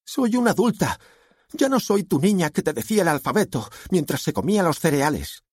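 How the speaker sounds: put-on high-pitched, insistent teenager; indignant daughter. A narrator's reading. Daughter insists she is adult.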